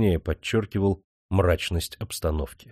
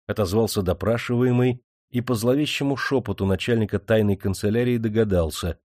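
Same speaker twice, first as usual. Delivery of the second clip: ordinary delivery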